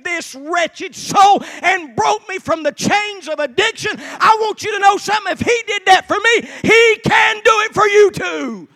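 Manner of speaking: high, shouted testimony building to a climax; full-force preaching. Real, not acted. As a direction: shout it out, stretching the key words.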